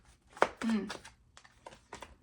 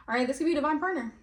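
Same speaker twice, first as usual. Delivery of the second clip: higher, louder and fast, a sudden eager burst; excited; 5 semitones higher, 3 dB louder, faster than usual. Real, not acted. Reader excitedly announces a divine partner card. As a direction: blurt out the discovery. Repeats card reading with emphasis.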